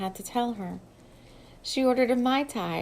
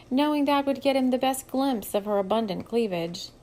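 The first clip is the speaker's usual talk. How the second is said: ordinary delivery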